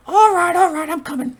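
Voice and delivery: very high, piercing Cockney-tinged squeal, like Pinky; Skull's squeaky voice. Retold or imitated. Fan imitating Skull giving in to Bulk, piercing high squeal. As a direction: pitch way up into a shrill cartoon squeak. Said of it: "he used to come back with".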